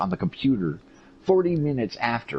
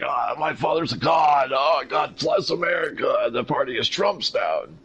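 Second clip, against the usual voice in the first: higher, put-on mock voice of Eric Trump; 7 semitones higher than usual. Mocking a TV guest on Fox News, put-on voice. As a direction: ham up a gushing TV guest. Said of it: "And he's like".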